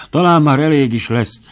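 high, loud, mildly winded; a touch out of breath; panting. A narrator's reading. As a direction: plead for a rest from dancing.

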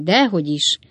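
ordinary delivery

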